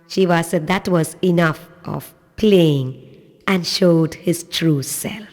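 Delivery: ordinary delivery, nothing marked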